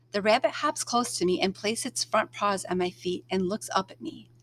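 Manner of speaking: ordinary delivery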